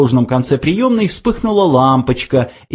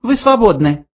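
curt high dismissal; high mouse voice; 11 semitones higher than usual. A narrator's reading. Benjy dismisses the old man, high curt. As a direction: end it coldly.

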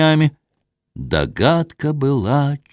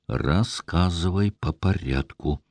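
low command; 4 semitones lower, 6 dB quieter than usual. A narrator's reading. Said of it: "приказал сквозь зубы".

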